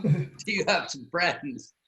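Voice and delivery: ordinary delivery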